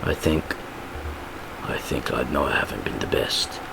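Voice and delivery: low, soft heartfelt talk; hushed, sombre confession; Butcher. Acted. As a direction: speak low and haltingly, with long pauses. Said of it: "Then Butcher and Ryan".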